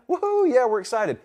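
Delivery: high, loud woohoo like a Texas fan; mock fan cheer. Host mimicking thrilled Texas fans, mock cheer. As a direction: cheer as the Austin crowd. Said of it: "Texas are excited about it".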